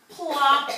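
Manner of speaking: second landing plop. Acted. Frog lands on lily pad.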